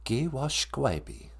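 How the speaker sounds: Giwashkwebi said slowly and clearly; slow Ojibwe teaching. Shown as a demonstration. Teacher slowly sounding out Giwashkwebi for the listeners. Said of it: "if you drink too much".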